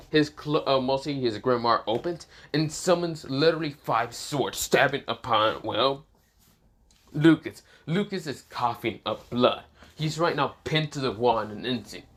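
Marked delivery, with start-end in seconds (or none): none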